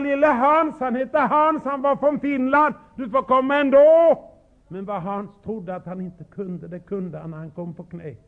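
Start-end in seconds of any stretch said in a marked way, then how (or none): 0.0-4.2 raised, coaxing reassurance; reassuring. Retold or imitated. Preacher retelling how he reassured little Hans, raised and coaxing. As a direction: call the boy gently but loudly. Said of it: "då sa jag".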